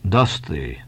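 put-on low, hollow old Quaker voice; Bildad hollow tone. A narrator's reading. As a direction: speak low and hollow, solemn. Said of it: "said Bildad in a hollow tone".

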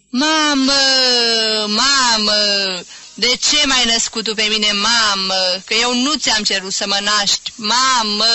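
put-on loud, drawn-out drunken lament; drunken wail. A narrator's reading. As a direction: wail to your mother from the bar. Drunk reveller wails in restaurant. (bocet de bețiv, tare și tărăgănat)

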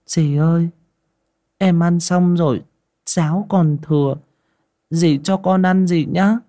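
put-on high child's voice, politely asking; pleading little girl. A narrator's reading. Little girl asks stepmother for leftover porridge, pleading.

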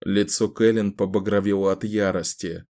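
plain reading voice

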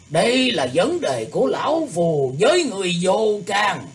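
angry shout. A narrator's reading. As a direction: shout it at him in anger. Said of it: "tức giận quát tháo ầm ĩ".

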